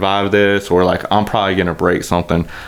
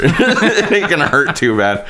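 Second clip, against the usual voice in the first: slow, higher, through laughter; laughing; 8 semitones higher, slower than usual. Real, not acted. Retelling his hope before hitting sand, laughing. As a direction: laugh through the words, stretched out.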